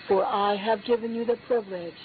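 normal talking voice

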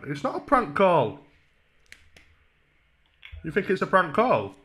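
ordinary delivery

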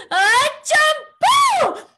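high, loud, slow champú like a sneeze. Shown as a demonstration. Teacher showing kids champú like a sneeze, high and loud. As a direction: build up "uh, uh" then burst "champú" like a sneeze. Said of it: "say it like you're about to sneeze".

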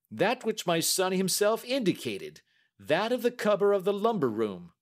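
high, emphatic banker; agitated banker. Acted. Banker telling the detective which key was used, agitated. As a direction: answer emphatically with high pitch.

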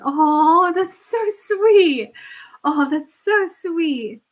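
high, slow, melting "so sweet"; slow, high-pitched awe; touched cooing. Real, not acted. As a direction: coo it like you're deeply moved. Cooing over an emotional moment.

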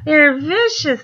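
high, loud whiny mock alarm; mimicking panicky folks with a cry in it; mocking quote. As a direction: parrot scared people with a sneer. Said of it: "the first thing they do is".

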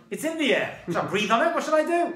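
acted-out incredulous retort; baffled protest. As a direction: throw up the questions in comic bewilderment. Acting out his baffled retort.

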